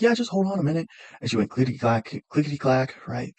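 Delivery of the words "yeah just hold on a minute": high, chirpy receptionist. Retold or imitated. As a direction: mimic the helpful receptionist. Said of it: "And she was like".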